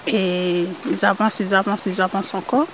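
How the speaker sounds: plain reading voice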